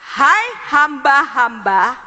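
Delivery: slow, emphatic scripture proclamation; scripture reading. Real, not acted. Preacher reading Kolose to the congregation, slow proclamation. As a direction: proclaim the verse word by word.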